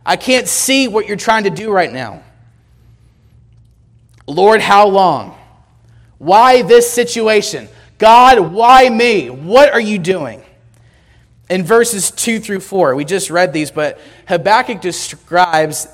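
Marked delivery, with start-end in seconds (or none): 0.0-10.4 pleading shout heavenward, breaking into why me; crying out to God. Retold or imitated. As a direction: call out like someone at the end of his rope. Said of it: "you've shouted to God".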